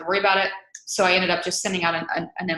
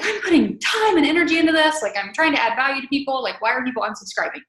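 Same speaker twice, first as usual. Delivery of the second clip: exasperated; 6 semitones higher than usual. Retold or imitated. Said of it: "I was like".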